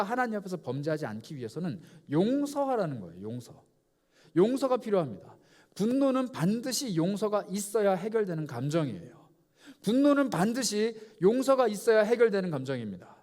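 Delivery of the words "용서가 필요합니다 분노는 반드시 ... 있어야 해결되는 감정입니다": higher and louder, building to a peak; rising preaching. Real, not acted. Preacher proclaiming to the congregation, loud and high. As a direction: proclaim it with growing force.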